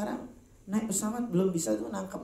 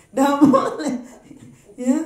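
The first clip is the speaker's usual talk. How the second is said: louder, laughing denial; 9 semitones higher, 12 dB louder, slower than usual. Real, not acted. Preacher laughingly forbids mocking lips. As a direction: scold through a laugh.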